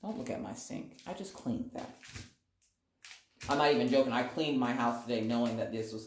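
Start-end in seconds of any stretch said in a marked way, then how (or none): 0.0-1.9 hushed embarrassed whisper; whispered aside. Real, not acted. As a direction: whisper it off to the side.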